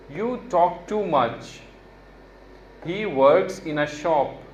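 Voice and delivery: very slow, clear sentence reading; slow model reading. Shown as a demonstration. Teacher reading practice sentences slowly to students. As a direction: read each sentence slowly for students to copy. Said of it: "जिस तरह से मैंने इन sentences को पढ़ा है, make sure कि आप उसी तरह से पढ़ें".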